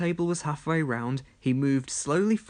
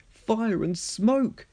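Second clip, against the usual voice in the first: slow, higher cry of alarm; alarmed shout; 7 semitones higher, much slower than usual. Acted. Thomas sinking into the mine, slow alarmed shout. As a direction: cry out as the ground gives. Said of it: "shouted Thomas".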